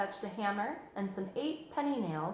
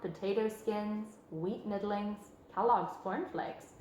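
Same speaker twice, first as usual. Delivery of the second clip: ordinary delivery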